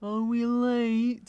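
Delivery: loud, drawn-out slow-motion call; mock slow motion. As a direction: stretch every word out, high and loud. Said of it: "they do it in slow motion".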